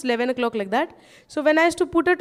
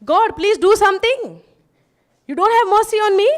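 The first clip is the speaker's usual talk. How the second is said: louder, rushed cry to God; desperate plea; 7 semitones higher, 6 dB louder than usual. Retold or imitated. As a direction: beg God in despair, words tumbling. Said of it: "prayer was what?".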